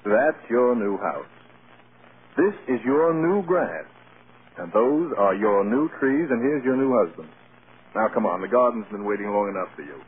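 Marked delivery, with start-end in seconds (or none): 0.1-6.3 slow, spelled-out telling, each phrase apart; very slowly. Real, not acted. Husband slowly repeating the tour for his dazzled bride. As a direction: lay it out slowly so she can take it in. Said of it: "Would you mind telling me again, very slowly?".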